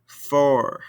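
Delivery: "Fort" ending on the R; silent final T. Shown as a demonstration. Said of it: "these ending consonants".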